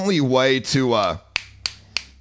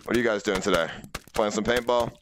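deep pitch shifted; 7 semitones lower, 4 dB quieter than usual. Real, not acted. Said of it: "I'm using a voice changer".

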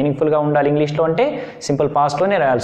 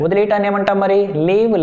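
usual speaking voice

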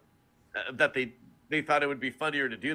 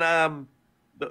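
usual speaking voice

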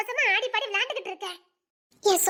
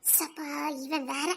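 fed up that he turned up again, low and slow; exasperated low sigh; 6 semitones lower, 7 dB quieter, much slower than usual. Acted. Annoyed woman sighing low about the man arriving.

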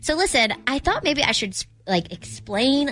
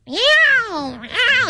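high meow imitation; cat meowing; 12 semitones higher than usual. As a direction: mimic an annoying cat. Imitates the cat's noise.

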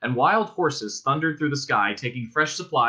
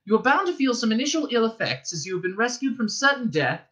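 high, composed welcome announcement; stewardess voice; 9 semitones higher than usual. A narrator's reading. Ship's voice welcoming rescued passengers, stewardess voice. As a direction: announce it like cabin crew.

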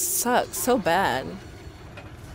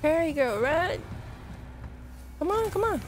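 being chased; 6 semitones higher, 6 dB quieter than usual. Real, not acted. Urging character to flee.

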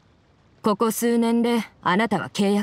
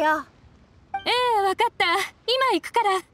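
put-on much higher, motherly answer to a child; gentle mother; 10 semitones higher than usual. Mother answering her running son, high and gentle. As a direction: answer your little one kindly.

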